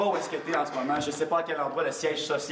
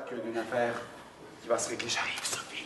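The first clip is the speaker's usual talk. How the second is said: whispered, softer promise; 3 semitones lower, 6 dB quieter than usual. Acted. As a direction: breathe it as a secret.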